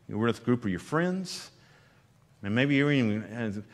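ordinary talk, nothing marked

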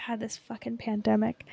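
ordinary talk, nothing marked

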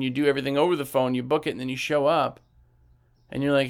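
ordinary talk

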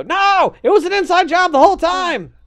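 high, full-force shout; hammed-up Star Wars cry of betrayal; mock betrayed outcry. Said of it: "and we go".

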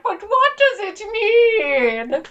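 high, slow, pondering whine; pretentious English major. Retold or imitated. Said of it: "walking around being like".

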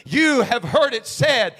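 loud, high fervent preaching; full-throated pulpit cry. Acted. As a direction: shout it out like a revival preacher.

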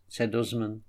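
usual speaking voice

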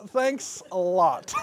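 slow, drawn-out sarcastic thanks; mock gratitude, slow and dry. Shown as a demonstration. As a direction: make the thanks drip with irony. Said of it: "sarcastic voice".

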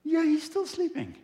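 high mock annoyed complaint; ticked off disciples. Disciples grumbling about sleeping Jesus, mock annoyed. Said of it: "They're a little bit ticked".

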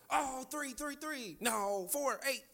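lightly voiced excited kid, high and soft; kids guessing numbers. Boys guessing at the bouncing lottery balls, lightly voiced excited kids. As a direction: call out guesses in a small high voice.

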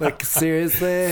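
slow, drawn-out mock girl voice; a hint of exaggerated girly disbelief. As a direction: lightly mimic an incredulous girlfriend, slowly. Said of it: "why do we do girl voices?".

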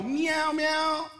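preacher imitating a cat for the church; cat meow. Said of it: "When you hear a cat go".

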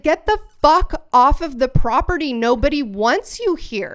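forceful yell, driving someone off; retold mad outburst. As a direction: re-enact getting in his face, furious. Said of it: "I was mad".

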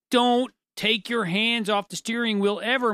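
ordinary talk, nothing marked

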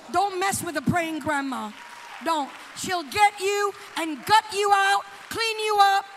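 loud, punched sermon warning; full-force preaching. Real, not acted. Preacher warning about praying grandmas, forceful preaching. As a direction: hammer each phrase to the congregation.

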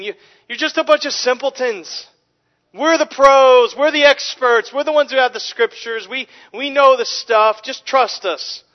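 high, loud, mocking know-it-all voice; arrogant Pharisees. Pharisees belittling their officers as simpletons, pompous mock scorn. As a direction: lampoon the smug experts talking down. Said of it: "they're speaking to these subordinates and saying".

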